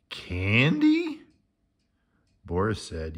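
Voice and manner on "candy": high, eager candy; excited question. A narrator's reading. Morris thrilled to hear of candy, eager high question. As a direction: leap up on candy with delight.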